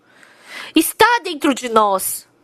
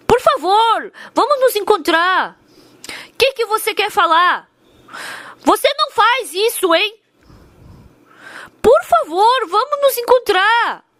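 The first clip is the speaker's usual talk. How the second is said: pleading; 7 semitones higher than usual. Retold or imitated. Loan shark begging the wife to meet, high pleading. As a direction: beg her to agree to meet.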